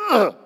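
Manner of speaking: short exertion grunt; mock weight-lifting grunt; demonstrated grunt. Shown as a demonstration. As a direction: push the breath out in a grunt. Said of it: "I'll grunt, something like".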